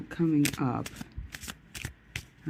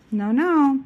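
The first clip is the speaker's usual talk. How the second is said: scolding the dog; 11 semitones higher, 10 dB louder than usual. Real, not acted. Telling the barking dog off.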